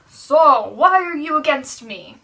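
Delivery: loud booming call of Saul's name; a loud divine voice calling Saul. Acted. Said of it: "And a loud voice asked".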